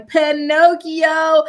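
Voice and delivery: slow, drawn-out mocking Pinocchio; a slow, sing-song jab of Pinocchio. Real, not acted. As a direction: draw the name out slowly, teasing. Mocking name for liar.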